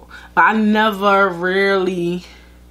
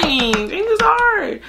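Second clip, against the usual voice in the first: slow, high drawn-out repeat; 12 semitones higher, much slower than usual. Shown as a demonstration. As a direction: lift it high and drag each word.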